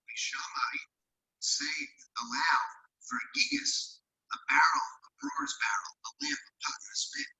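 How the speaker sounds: garbled, like talking from a tunnel; distorted line, hollow and whispery; echoing tunnel. Real, not acted. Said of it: "You sound like you're in a tunnel".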